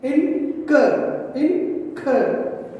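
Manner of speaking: "Incur" in two separate beats, in... cur; split into syllables. Shown as a demonstration. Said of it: "pronounce this word with the two syllables".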